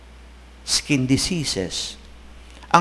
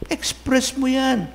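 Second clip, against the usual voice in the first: normal talking voice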